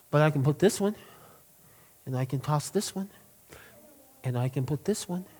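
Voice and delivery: quietly, tossing one at a time; soft boy's answer. Retold or imitated. Pastor voicing the boy answering the man, softly.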